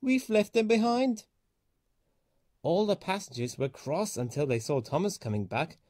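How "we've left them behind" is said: higher, rueful realisation; sheepish dismay. A narrator's reading. Thomas admits to the signalman, rueful and high. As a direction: admit the mistake. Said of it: "he said".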